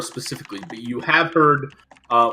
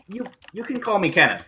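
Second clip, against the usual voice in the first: lightly voiced character, higher and quick; a hint of a higher voice for an introduction; quick, higher-pitched character greeting; Kenneth; 7 semitones higher, faster than usual. Game master voices shy Kenneth.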